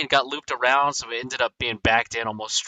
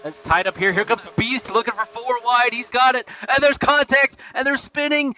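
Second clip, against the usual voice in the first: calm to high, loud crash call; building excitement; 11 semitones higher, 5 dB louder than usual. Real, not acted. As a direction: climb to a frantic wreck call. Wreck call building in race.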